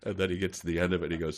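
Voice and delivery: ordinary talk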